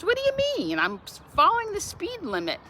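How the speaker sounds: put-on high, indignant old lady voice; old mom. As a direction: protest like a puzzled old woman. Old mom voiced protesting police.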